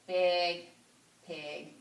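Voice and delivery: usual speaking voice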